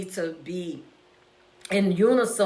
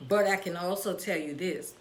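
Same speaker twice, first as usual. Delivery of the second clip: usual speaking voice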